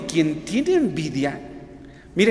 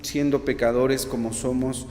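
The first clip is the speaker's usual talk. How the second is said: soft, earnest prayer voice; oración; 5 semitones lower, 5 dB quieter than usual. Acted. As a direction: pray humbly and quietly to the Lord. Opening prayer before the sermon. (oración suave y reverente)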